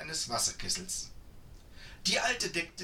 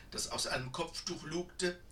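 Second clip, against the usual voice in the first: plain reading voice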